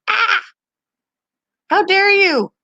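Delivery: high-pitched mock outrage; outraged mother hamster. Mother hamster scolding the owner for uncovering babies, high mock outrage. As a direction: squeak it like an offended little mom. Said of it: "She's like".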